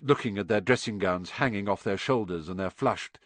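usual speaking voice

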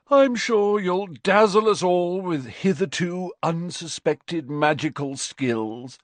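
high, drawling mock yawn; yawned-out sarcasm, slowing at the end. A narrator's reading. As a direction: tease as if bored, stretching the ending. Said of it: "yawned Fred".

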